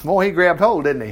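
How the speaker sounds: normal talking voice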